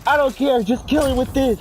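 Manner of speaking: high, loud insistent mom. Retold or imitated. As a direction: squeal don't care, kill him. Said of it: "she was like".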